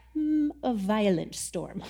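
slow, musing editor voice; mock pondering. Translators reconsidering the verse, slow musing mock voice. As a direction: play a fussy translator mulling it over. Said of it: "the N I V was like".

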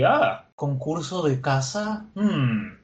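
slow, drawn-out musing; acted pondering, stretched out; thinking to oneself. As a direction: let an idea dawn, then hum on it. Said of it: "de pronto piensan para sí mismos".

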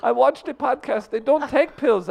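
high put-on voice; high-voiced visitor. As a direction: chime in as a squeaky visitor.

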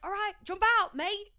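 high, loud mock Australian; attempted Australian accent. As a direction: call out in a bad Australian accent. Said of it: "I can't do Australia".

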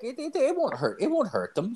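lightly hammed-up Irish drinker voice; old Irish guy. Imitating Tommy the old Irish drinker, high-pitched. Tommy reassures about puke.

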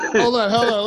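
ordinary talk, nothing marked

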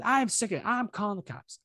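high-pitched imitation of a fed-up neighbor; hint at her outrage without shouting; mock angry threat. Retelling the neighbor threatening police, mildly mock angry. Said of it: "this woman is yelling at him".

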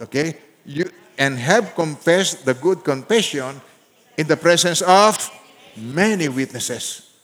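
slow, loud unison scripture reading; reading aloud together. Shown as a demonstration. Preacher reading with congregation, slow and loud. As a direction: carry the whole church through the verse. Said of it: "Sabay-sabay, basahin ninyo".

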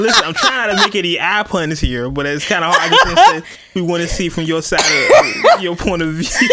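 laughing through it. Real, not acted. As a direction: try to speak but keep breaking up. Host laughing through fake apology.

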